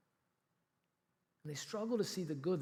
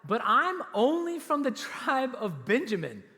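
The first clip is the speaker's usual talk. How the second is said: put-on a humble young man objecting, higher and louder; protesting Saul; 7 semitones higher, 7 dB louder than usual. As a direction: act out Saul shrinking from the call, voice raised. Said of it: "Saul replied".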